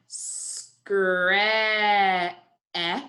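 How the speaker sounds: "Scra-" stretched, stopping on a long vowel; slow sounding out. Shown as a demonstration. Teacher helping class hear the vowel, slowly stretched. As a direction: hold the a in "Scra-", then isolate it. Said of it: "say it slowly again".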